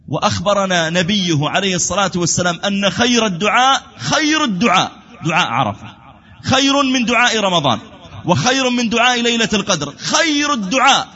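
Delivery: high, insistent preacher's voice; impassioned preaching. Acted. Preacher extols the supplication of Arafah, impassioned preaching.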